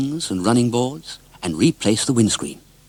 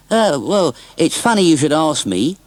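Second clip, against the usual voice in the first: put-on higher, chatty old dealer voice; a cheerful scrap merchant rambling on; 5 semitones higher, 3 dB louder than usual. Scrap merchant chatting to Mister Oldcastle, higher and chatty. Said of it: "said Mister Ebenezer Hearn".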